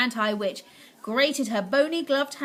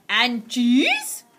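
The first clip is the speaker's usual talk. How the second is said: witch shouting; 7 dB louder than usual. Acted. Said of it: "shouted the Grand High Witch".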